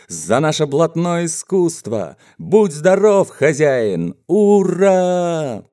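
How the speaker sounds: high-pitched shouted toast; rowdy drunken toast. Acted. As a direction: cry out a toast for the whole gang. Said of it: "горласто закричали пьяницы". (горластый пьяный тост)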